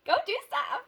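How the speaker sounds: high, loud mock command; playful yell. Urging the viewer outside with a high playful yell. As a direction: shout it out to get them moving. Repeats her own line, yelled.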